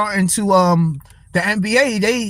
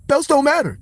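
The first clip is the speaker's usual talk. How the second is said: higher, lightly voiced cocky boxer brag; Floyd Mayweather; 5 semitones higher than usual. Streamer imitating a boxer who dismisses belts, light cocky brag. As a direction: toss it off like a cocky champ who doesn't care. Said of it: "where they could talk like Floyd".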